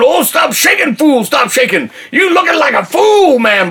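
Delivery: high, frantic mock scolding; frantic self-scolding. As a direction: plead with your own body, pitch shooting up. Acts out scolding his shaking body.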